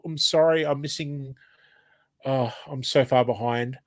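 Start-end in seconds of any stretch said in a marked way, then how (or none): none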